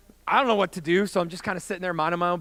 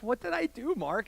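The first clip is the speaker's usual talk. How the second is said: high, softer acted plea; sheepish question; 5 semitones higher, 6 dB quieter than usual. Young man calming down asks his buddy, soft high question. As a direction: ask small and high, dropping at the end.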